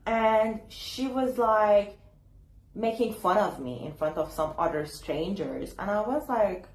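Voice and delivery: ordinary delivery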